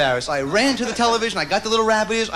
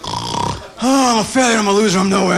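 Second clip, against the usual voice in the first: louder, mock-moaning self pity; gloomy grandfather; 5 dB louder than usual. Grandson imitating his depressed grandfather, mock moaning. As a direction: ham up a depressed old man. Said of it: "He used to go".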